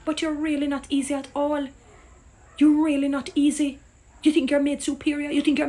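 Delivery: really hammered in rising disbelief; indignant outburst. Real, not acted. Repeats phrase with rising outrage.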